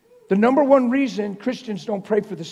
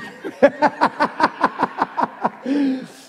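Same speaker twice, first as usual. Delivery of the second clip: higher laugh rolling on; chuckling laugh; 8 semitones higher, 3 dB louder than usual. Real, not acted. The preacher laughs about running over time.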